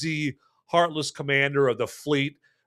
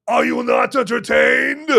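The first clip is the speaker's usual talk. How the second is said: loud, high arena challenge; Maximus; 10 semitones higher, 6 dB louder than usual. Retold or imitated. Gladiator challenging the crowd in the arena, loud mock roar. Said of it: "when Maximus asks you".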